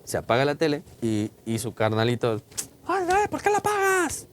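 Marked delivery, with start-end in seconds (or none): 2.9-4.3 put-on much higher whining little boy; whiny kid. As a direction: whine like a small kid. El hermanito se queja. (el hermanito se queja con voz de niño mucho más aguda)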